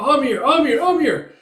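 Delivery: high, loud cries of a name; acted frantic name-calling; urgent calling out. Calling to Amir during the fight, urgent cries.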